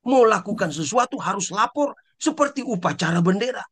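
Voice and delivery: ordinary talk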